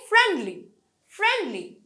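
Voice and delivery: Friendly with high, falling friend; stress on root word. Shown as a demonstration. Teacher drilling derived words, friendly stressed on friend. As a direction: punch friend, keep লি light in friendly. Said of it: "main ওয়ার্ডটাতে আমরা সবসময় stress দিই".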